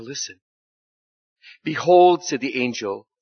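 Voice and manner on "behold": grand proclamation. Acted.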